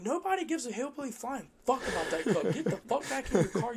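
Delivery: laughing while re-yelling at the lady; retold yelling. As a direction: yell it back at her, laughing. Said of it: "I'm like".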